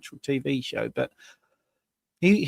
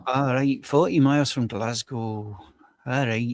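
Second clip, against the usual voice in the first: lower-pitched put-on Scottish accent; 3 semitones lower than usual. Teasing co-host echoing the birthplace, Scottish accent. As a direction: echo it back in broad Scots. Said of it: "you can do the accent better than me".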